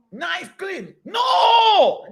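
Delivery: sudden high shout of No; mocking shout. Real, not acted. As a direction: burst out loud in mock disbelief. Mocking lazy people, loud outburst.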